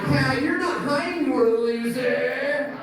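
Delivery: high mocking singsong, laughing; high sing-song taunt. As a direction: gloat at them with a laugh. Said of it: "And I'm like".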